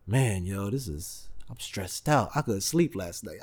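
low, soft worried mumble from the stressed guy; mock stressed mumble. Stressed test-taker mumbling to the speaker before the exam, low and soft. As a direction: play the anxious test-taker muttering.

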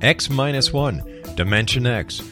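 ordinary delivery

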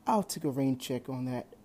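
plain reading voice

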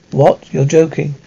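ordinary delivery

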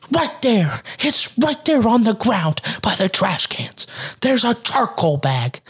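high, slow, insistent mimicry; irate neighbor lady. Lady pointing out the charcoal bag, mock indignant. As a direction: drag out each word in mock indignation. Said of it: "She's like".

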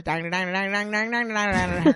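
nonsense Southern twang, rattled off; imitated thick Southern accent. Guest mimics how he used to talk, Southern gibberish. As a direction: parody incomprehensible Southern talk. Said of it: "before it was just like".

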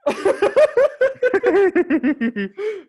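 long, high, loud laughter; real laughing fit. Real, not acted. Cracking up at own late realization, long high laugh. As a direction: crack up helplessly at yourself.

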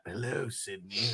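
low, soft menacing greeting; killer's phone voice. Acted. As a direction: sink the voice for a creepy caller. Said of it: "That was the first one".

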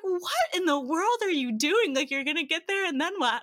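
high, loud, incredulous parent; mock a baffled father's scolding; retold exasperated dad. Dad questioning daughter's Germany plan, exasperated. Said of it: "he was like".

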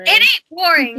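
high, loud indignant rebuttal; real burst of loud objection; loud protest. Real, not acted. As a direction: shout down the insult to your town. Defending Dallas against a friend.

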